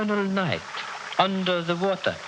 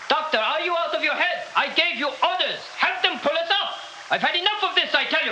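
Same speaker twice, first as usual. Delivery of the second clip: higher, rising furious orders; angry demands; 7 semitones higher than usual. Acted. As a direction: lose patience and bark at him. Dictator furious at disobeyed orders.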